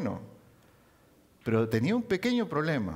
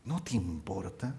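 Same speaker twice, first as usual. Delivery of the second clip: hushed complaint; 7 semitones lower, 7 dB quieter, slower than usual. Acted. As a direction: drop to a low murmur, slow. Marta's complaint, hushed mock. (Marta reprochando en voz baja)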